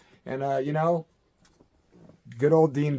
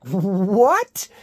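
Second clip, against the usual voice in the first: shocked what; 6 semitones higher, 7 dB louder than usual. Retold or imitated. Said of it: "I'm like".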